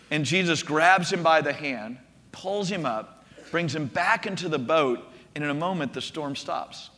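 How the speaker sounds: ordinary delivery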